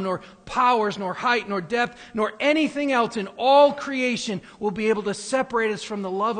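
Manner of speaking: loud, high, rising sermon climax; thundering preacher, slowing on the last words; preaching at full force. Acted. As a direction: build the Romans passage into a ringing proclamation. Reads Romans 8 building to climax.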